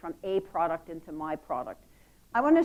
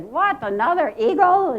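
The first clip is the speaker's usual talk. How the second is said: higher, louder mock outrage of a kid; surprised child; 6 semitones higher, 6 dB louder than usual. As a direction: play a child groaning at a repeat. Kid reacting while being read to.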